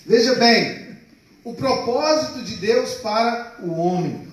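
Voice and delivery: usual speaking voice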